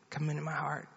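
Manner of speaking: retold child's quiet repeat; child echoing prayer. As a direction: echo the prayer line in a hushed voice. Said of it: "You just pray after me".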